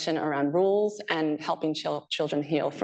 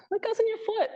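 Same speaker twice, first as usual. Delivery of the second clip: put-on much higher childlike question; child laughing correction. Child correcting a parent with socks on hands, high playful question. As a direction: pipe up like a giggly toddler.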